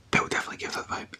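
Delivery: normal talking voice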